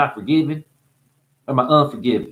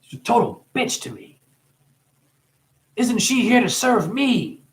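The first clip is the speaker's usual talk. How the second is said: mocking complaining customer; 9 semitones higher than usual. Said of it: "And he said".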